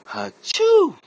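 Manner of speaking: big theatrical achoo, high and loud; a cartoonish sneeze that sprays everything; loud fake sneeze. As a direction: blast out a hammed-up sneeze. Comic acts out a sneeze.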